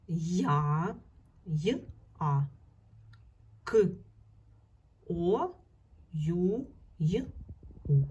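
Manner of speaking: я split into й, а and ю into й, у; each sound on its own with pauses; sound-by-sound breakdown. Shown as a demonstration. Teacher building a sound scheme of якою, sounding out. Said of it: "буде звукова схема".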